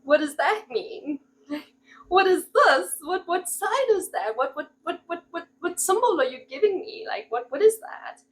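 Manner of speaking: bewildered, searching for meaning in a sign; baffled panic. Retold or imitated. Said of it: "and you're like".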